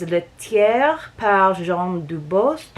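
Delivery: very slow French accent; Le tiers par Jean Dubost said slowly the French way. Shown as a demonstration. Host reading the French brand line, French accent. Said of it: "I try to do my best French accent for you".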